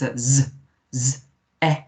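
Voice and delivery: "Z" buzzed alone, then short "E" as eh; Z sound. Shown as a demonstration. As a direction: separate phonics "Z, E", not letter names. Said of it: "S is a Z sound".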